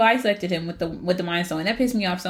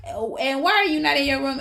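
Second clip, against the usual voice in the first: lightly voiced stern mom, higher; a hint of a mother catching her boy; scolding mother; 6 semitones higher than usual. Frigg scolds her son Thor.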